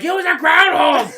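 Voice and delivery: high, loud shouted announcement; excited shout. Retold or imitated. Bursting inside to tell his wife, a high excited shout. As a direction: burst in yelling the news. Telling wife after running in.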